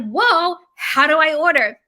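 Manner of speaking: loud, high mock "whoa" from a puzzled customer; surprised customer. To viewers, voicing a customer who suddenly wants to order: loud surprised call. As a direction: act out a customer caught off guard, calling out. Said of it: "if you're like".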